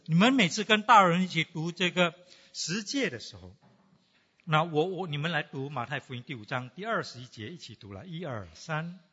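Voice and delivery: usual speaking voice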